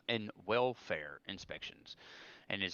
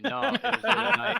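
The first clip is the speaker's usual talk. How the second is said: high, loud, nervous-recruit voice; squeaky recruit voice; 13 semitones higher, 9 dB louder than usual. Retold or imitated. Recruit answering the senior drill sergeant: high, loud mock squeak. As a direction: squeak it out like a scared private. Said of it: "I was like".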